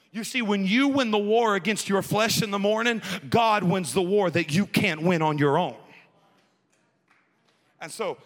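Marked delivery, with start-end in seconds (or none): 0.1-5.8 louder, pressing each phrase; full-force preaching. Acted. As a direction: preach it out to the congregation. Preaching peak after Daniel story.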